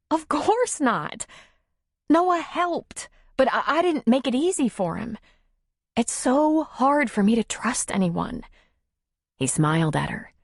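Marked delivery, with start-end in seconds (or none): 0.1-8.4 high, insistent woman's voice; emphatic Nora. Acted. Nora defending Noah to Tom, emphatic. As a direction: defend him high and earnest, then confess. Character dialogue.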